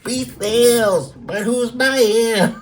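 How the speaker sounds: high, slow mocking voice. Vlogger jeering at a string ad, high mocking voice. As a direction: keep the high sneer going.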